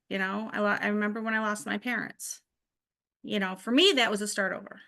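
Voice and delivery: ordinary talk, nothing marked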